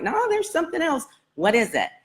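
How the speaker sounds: very high quoted insistence; high, coaxing healer's question; insistent probing. Retold or imitated. Healer presses her for an answer.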